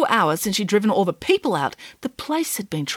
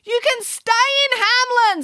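high, near-crying offer; a desperate mayor giving in; tearful mayor. A narrator's reading. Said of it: "cry heard".

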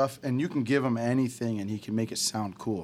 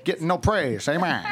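put-on 1920s Chicago mobster accent, raised pitch; gangster voice; 5 semitones higher, 4 dB louder than usual. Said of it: "use that voice".

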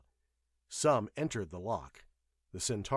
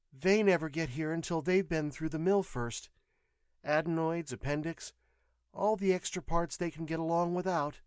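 higher-pitched nurse's voice; explaining nurse; 7 semitones higher than usual. A narrator's reading. Nurse answers the patient about the men, explanatory. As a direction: lay it out in a raised female register.

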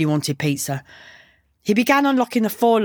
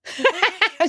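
short, high bark of laughter; sudden high-pitched acted laugh; 13 semitones higher than usual. A narrator's reading. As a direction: burst out with a brief scoffing laugh. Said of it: "She barked a laugh".

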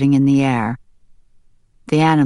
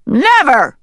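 high, loud defiant never; ducks quacking never; 12 semitones higher, 6 dB louder than usual. Acted. Ducks defying the hen, high and loud. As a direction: cry it out together in defiance. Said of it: "quacked the ducks".

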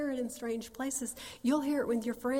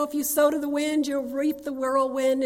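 King James mother; 3 semitones higher, 5 dB louder than usual. Retold or imitated. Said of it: "she speaks King James".

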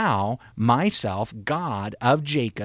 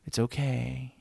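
softer, gentle reassurance; soft consoling; 6 dB quieter, slower than usual. Shown as a demonstration. Not God's tone, a gentle soothing contrast. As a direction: soothe like comforting a child. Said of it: "the words here are not".

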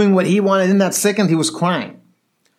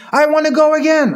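high, whiny mock wail; crying child; 12 semitones higher, 4 dB louder than usual. Nine-year-old demanding another ride, mock crying. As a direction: imitate a kid starting to cry. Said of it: "he starts crying".

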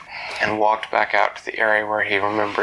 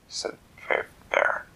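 ordinary delivery, nothing marked

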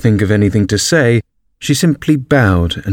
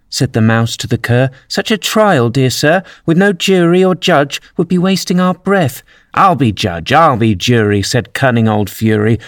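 rhythmic poem reading, often pitched higher; verse recitation; 4 semitones higher than usual. Acted. Mouse recites the tale of Fury, in rhythmic verse.

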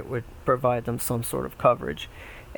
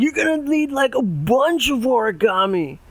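frat bro; 11 semitones higher, 5 dB louder than usual. Acted. Pig two voiced in story.

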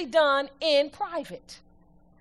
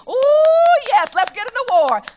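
mock eager; 9 semitones higher, 9 dB louder than usual. Mocking eager award seekers.

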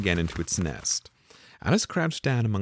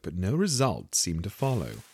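ordinary delivery

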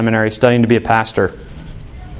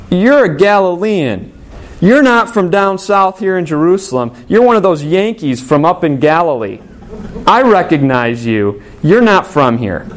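accusing bystanders; 5 semitones higher than usual. Acted. Crowd accusing Peter.

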